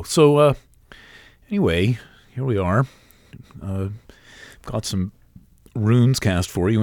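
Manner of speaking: talking through the hiccups; small hiccup catches in the voice. Real, not acted. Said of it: "sorry, I'm hiccuping".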